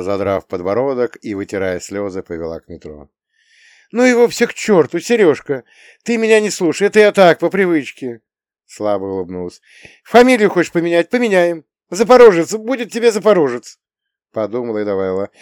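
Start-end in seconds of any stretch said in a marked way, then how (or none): 3.9-8.2 high, loud, impulsive outburst; emphatic reassurance. A narrator's reading. Mother telling Серёжка not to listen to her, emphatic reassurance. 10.0-13.8 high, loud, emphatic outburst; eager promising. A narrator's reading. Mother giving in to her son's wishes, eager and emphatic.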